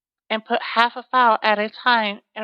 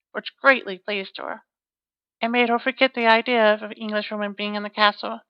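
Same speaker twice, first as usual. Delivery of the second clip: ordinary delivery, nothing marked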